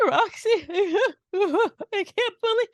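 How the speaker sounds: high, shaky fake crying; forced cry voice. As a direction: whimper it out like a fake sob. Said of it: "shaky, forced cry voice".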